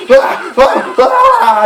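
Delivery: high mock screams; short high screams of a bitten man; screaming in pain. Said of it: "bleeding and screaming".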